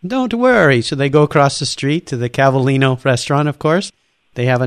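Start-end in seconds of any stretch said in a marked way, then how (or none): 0.1-0.8 very high, singsong "don't worry"; breezy staff. Retold or imitated. Staff soothing the angry customer, high and airy. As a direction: brush it off airily.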